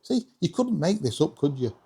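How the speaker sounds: ordinary talk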